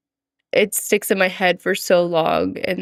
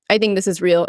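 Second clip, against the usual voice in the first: ordinary talk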